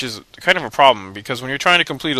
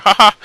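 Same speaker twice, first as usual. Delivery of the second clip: loud, higher goofy laugh; silly laugh; 9 semitones higher, 11 dB louder than usual. Real, not acted. Laughing at the swordsman in the game, loud goofy ha ha. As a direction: belt out a goofy ha ha. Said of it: "I like my laughter too".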